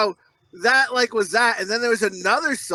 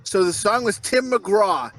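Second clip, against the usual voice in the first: usual speaking voice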